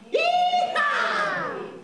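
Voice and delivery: a high, loud cowboy holler; excited puppet yeehaw. Acted. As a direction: whoop it out like a happy cowboy. Puppet cheers after the prayer.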